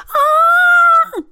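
high joyful falling scream. A narrator's reading. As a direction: scream while plunging down. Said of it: "но могла лишь кричать".

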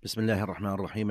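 normal talking voice

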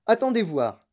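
lifted, teasing call; excited cry. A narrator's reading. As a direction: call out to make them wait. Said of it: "cria monsieur Wonka".

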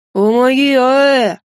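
muffled loud cry through a gag; gagged. Acted. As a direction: yell for help into a gag. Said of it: "приглушённо возопил Эйн".

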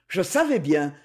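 ordinary delivery, nothing marked

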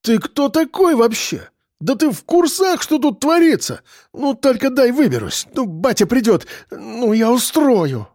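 high-pitched furious rant; trembling with anger. Acted. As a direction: splutter threats in a strained, high voice. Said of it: "Он аж задрожал от злости".

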